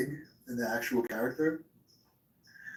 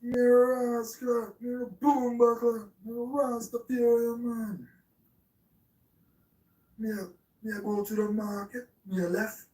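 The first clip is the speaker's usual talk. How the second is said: mock Rasta character; 9 semitones higher than usual. Streamer clowning for chat as he dresses, high mock Rasta voice.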